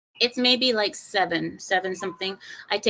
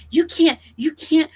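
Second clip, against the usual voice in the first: mock scolding clerk; 7 semitones higher, 7 dB louder than usual. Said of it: "She's like".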